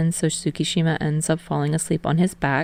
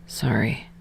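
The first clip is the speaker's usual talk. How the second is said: a whispered half-asleep sorry; muttered; 8 semitones lower, 8 dB quieter than usual. A narrator's reading. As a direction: breathe it out, barely voiced. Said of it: "he mutters".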